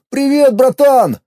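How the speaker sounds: high, slow hammy bro greeting; mock buddy greeting. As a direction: ham up a friendly hello. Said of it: "Типа я ему".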